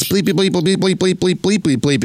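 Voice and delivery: playful bleeping in place of the swear words; mock censor beep. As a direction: imitate the TV bleep over cursing. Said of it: "we beep out".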